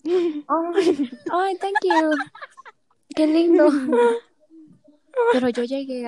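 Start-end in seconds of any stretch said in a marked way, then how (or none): 0.1-3.7 high, sugary thank-you with a sob; polite fake delight. Thanking the boy for three chocolates, high and gushing. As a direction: gush a forced thank-you.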